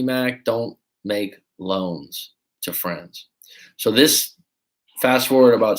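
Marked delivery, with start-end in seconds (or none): 0.0-3.3 slow self-warning. Real, not acted. Lesson told to himself.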